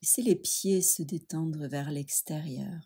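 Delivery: ordinary talk, nothing marked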